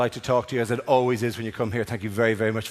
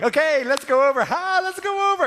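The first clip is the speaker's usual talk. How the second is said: high, forced jolly sing-out; fake cheerfulness; 12 semitones higher than usual. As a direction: pretend you are delighted to go. Fake cheer toward crying woman.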